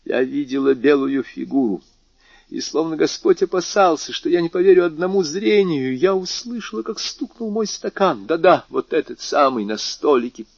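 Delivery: put-on high, insistent old woman; old lady swearing she saw it. Marquise voiced in story.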